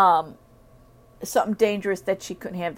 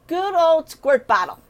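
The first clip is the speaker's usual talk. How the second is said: bright idea; 8 semitones higher, 5 dB louder than usual. Retold or imitated. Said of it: "So I thought".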